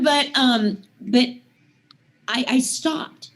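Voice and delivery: normal talking voice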